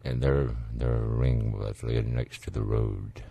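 hammed-up sad ballad line, slow and hushed; mock tearjerker lyric. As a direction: croon it like a sappy death song, half joking. Mocking tearjerker song lyrics.

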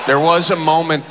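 ordinary talk, nothing marked

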